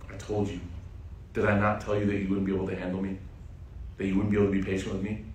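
quiet, quick told-you-so; a calm, hushed rebuke; low, soft reproach. Acted. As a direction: remind him softly and quickly. Khidr repeats his warning.

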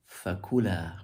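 "fakula" with the ku stressed, falling after; "fakula", peak on ku then drop. Shown as a demonstration. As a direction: lean on the middle syllable of "fakula". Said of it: "more eloquent would be".

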